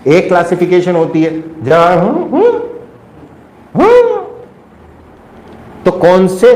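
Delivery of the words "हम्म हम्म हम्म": very high imitated grunting hums; primitive hums. Shown as a demonstration. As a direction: hum like early humans finding syllables. (ऊँची आवाज़ में आदिम गुनगुनाहट)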